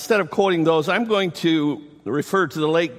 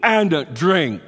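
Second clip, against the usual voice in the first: high, loud and slow proclamation; a loud preaching cry of the invitation; 11 semitones higher, 4 dB louder than usual. Acted. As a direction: proclaim it to the whole crowd. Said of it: "said in a loud voice".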